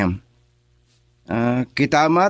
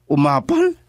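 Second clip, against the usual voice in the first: incredulous echo. Acted. Echoes her word in disbelief.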